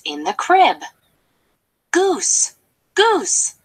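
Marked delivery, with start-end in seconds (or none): 1.9-3.6 calling out. Acted. Turkey calls goose.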